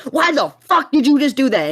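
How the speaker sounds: high, loud furious shout; yelling at Ben after the peroxide; angry yell. Retold or imitated. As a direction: yell it at the friend in outrage. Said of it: "I yell at Ben".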